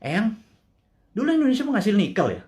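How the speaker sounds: lightly voiced grandchild, high and curious; grandchild asking. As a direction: ask grandma innocently like a little grandchild. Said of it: "Jangan sampai anak cucu kita bilang". (suara cucu yang polos, sedikit lebih tinggi)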